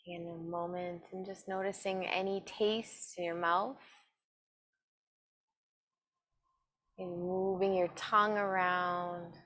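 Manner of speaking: slow, calm meditation voice with long pauses; guided meditation. Acted. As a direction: guide them gently inward, evenly and unhurried. Leads a seated meditation.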